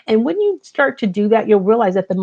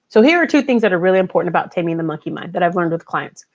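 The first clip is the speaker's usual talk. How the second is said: ordinary talk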